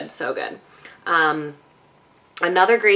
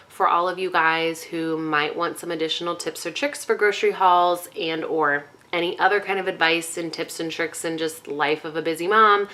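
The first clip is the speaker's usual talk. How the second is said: usual speaking voice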